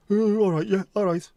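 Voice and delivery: high, loud hammed-up eager agreement; mock gullible voter. Mocking Brexit voters swallowing the promise, hammed-up high eager.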